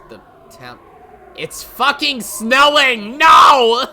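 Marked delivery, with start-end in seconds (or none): 1.4-3.9 very loud, high, drawn-out yell; slow outraged scream of disbelief; exasperated shout. Real, not acted. Gamer ranting at sudden snow.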